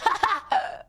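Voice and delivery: short, high real laugh; burst of laughter. Real, not acted. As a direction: crack up straight after speaking. Laughing at chat.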